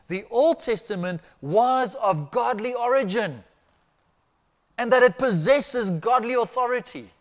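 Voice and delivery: high, loud, declaiming the quotation; reading a quote aloud. Retold or imitated. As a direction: proclaim the scholar's words with force. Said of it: "This is what he says".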